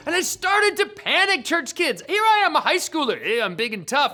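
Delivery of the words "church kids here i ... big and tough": normal talking voice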